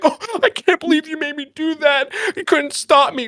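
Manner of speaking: high-pitched whiny complaint, imitated; mock whining. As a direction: wail the blame in a hammed-up high voice. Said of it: "hear you fucking bitch about it".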